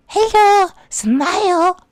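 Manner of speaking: high, loud fake-sweet greeting; mock cute pose voice. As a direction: chirp it like a posing model. Mocking a posed photo smile.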